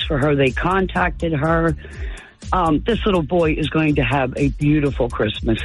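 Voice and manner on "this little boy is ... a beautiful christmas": ordinary talk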